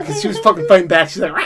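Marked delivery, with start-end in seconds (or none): 1.3-1.4 high angry cat yowl; imitated cat screech. Owner mimicking the cat resisting the cage, high screech. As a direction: screech like a cat fighting back.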